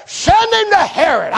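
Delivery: high, stretched-out order; gleeful dismissal. Retold or imitated. Pilate getting rid of his problem, gleeful dismissal. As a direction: send him off with relish.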